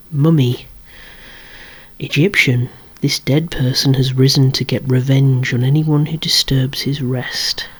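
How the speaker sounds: normal talking voice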